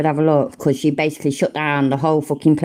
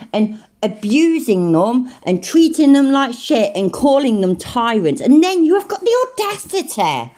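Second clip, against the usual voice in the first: raised pitch, scornful tirade; indignant rant; 7 semitones higher than usual. Real, not acted. Scolding the clip's cameraman over soldiers, indignant rant. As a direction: tear into him, leaning on the key words. Rants at the man in the clip.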